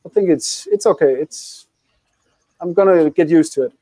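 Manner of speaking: usual speaking voice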